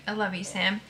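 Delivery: ordinary delivery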